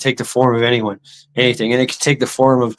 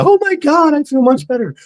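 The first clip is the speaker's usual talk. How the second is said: shrieking much higher, amazed; gushing believer. Retold or imitated. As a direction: squeal like a miracle just happened. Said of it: "you're like".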